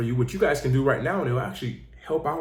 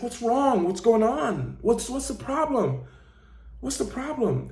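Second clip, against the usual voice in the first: worried father; 8 semitones higher, slower than usual. Retold or imitated. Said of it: "He's like".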